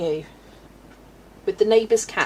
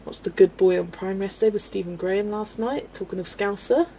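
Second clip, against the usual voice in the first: ordinary delivery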